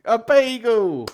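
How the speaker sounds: high, loud and drawn out; triumphant punchline. Acted. Comedian lands the seagull joke, triumphantly loud. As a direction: belt the punchline slowly to the back row.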